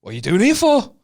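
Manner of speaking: mock drunk challenge; drunk bloke asking. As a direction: demand it like a drunk local. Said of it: "he went".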